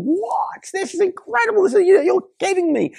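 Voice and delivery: high-pitched disbelief, voice jumping way up; astonished crowd. Retold or imitated. Bystanders watching the dead man walk out, shrill astonishment. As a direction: squeal it like you can't believe your eyes.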